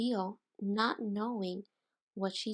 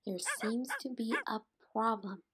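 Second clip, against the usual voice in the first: ordinary delivery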